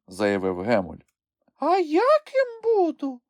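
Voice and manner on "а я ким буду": very high, slow little creature voice; drawn-out high plea to be included; eager not to be left out. A narrator's reading. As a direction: pipe up slowly, wanting a part too. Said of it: "не хотіла залишатися осторонь Хробця".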